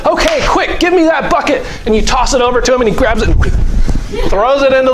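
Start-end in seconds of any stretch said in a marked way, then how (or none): 0.1-1.7 voicing Jesus. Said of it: "Jesus said".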